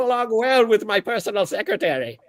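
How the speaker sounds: very high, fast, formal monster voice; a high, prim floating orb speaking; orb creature voice. Acted. GM as Gregory the orb, high and formal.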